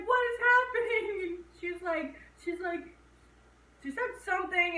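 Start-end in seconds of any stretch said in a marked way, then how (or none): 0.1-1.2 panicked question. Retold or imitated. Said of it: "so she's like".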